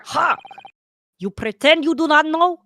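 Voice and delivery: mock accusing sailor.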